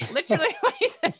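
talking through laughter; laughing high and fast. Real, not acted. Laughing while speaking.

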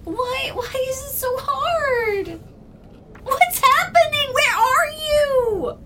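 high, tearful panicked pleading; distressed crying. Acted. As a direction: cry out in despair.

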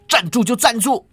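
high, emphatic comeback; defiant retort. A narrator's reading. Fleeing man answering his pursuer, defiant retort. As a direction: throw the order straight back.

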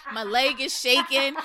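ordinary talk

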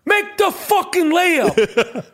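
high, slow shout breaking into laughs; a coach yelling at a lazy player; Danny Aiello coaching. Retold or imitated. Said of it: "That was Danny Aiello".

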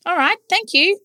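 put-on very high, happy child voice; beaming little boy. A narrator's reading. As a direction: thank him brightly like a small boy. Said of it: "Barry beamed".

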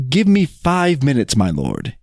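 ordinary delivery, nothing marked

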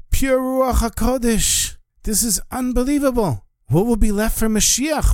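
as if beholding something sacred; Carl, stunned, reading the Rebbe's writings; awed marvel. Acted. Janitor marvels at holy writings.

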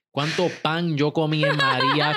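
laughing while telling it. Real, not acted. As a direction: chuckle through the words. Laughing about storm food.

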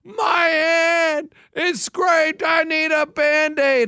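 high, slow, whimpering fake cry; a drawn-out mock wail from the sidewalk; mock toddler wail. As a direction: wail like a hurt three-year-old. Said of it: "He goes".

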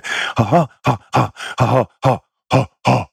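rhythmic forceful "ha" bursts; punched, breath-driven chanting of "ha"; energy-clearing huffs. Shown as a demonstration. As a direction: push out each "ha" sharply. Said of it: "there's too much stagnation".

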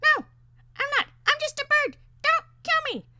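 very high, loud squeaky bird; piercing, falsetto parakeet plea; pleading parakeet. A narrator's reading. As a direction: beg for life in a tiny bird voice.

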